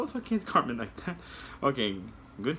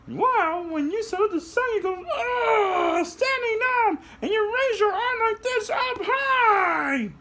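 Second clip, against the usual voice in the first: very high, slow, louder puppet flying; 4 dB louder than usual. Acted. Puppet shows kids how to fly, very high voice. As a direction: soar up into a tiny high voice. Puppet explains sun salute.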